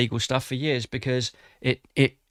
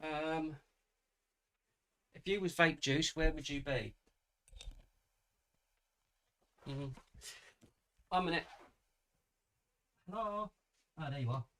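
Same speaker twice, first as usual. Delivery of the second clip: face down on the floor, faint and distant; talking into the carpet while searching; 4 semitones higher, 17 dB quieter than usual. Real, not acted. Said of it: "Sorry, I'm talking to the carpet".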